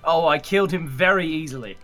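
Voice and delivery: ordinary delivery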